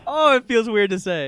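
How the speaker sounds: joyful reunion. Real, not acted. Reunion excitement.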